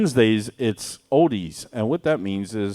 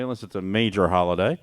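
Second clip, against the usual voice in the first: usual speaking voice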